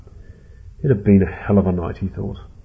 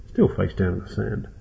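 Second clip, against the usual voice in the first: plain reading voice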